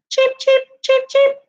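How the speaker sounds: very high tiny cheep cheep; high chirping chick sound. Retold or imitated. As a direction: peep like a little baby chick. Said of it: "They go".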